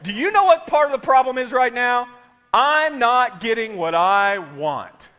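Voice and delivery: loud, slow attitude-filled retry; with stank. Shown as a demonstration. Preacher retries the line for congregation, sassy and loud. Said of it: "Let's try it again".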